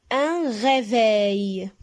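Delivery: Un réveil said slowly, syllables drawn out; slow model word. Shown as a demonstration. Teacher shows pupils the word, slow and clear. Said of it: "teacher models word".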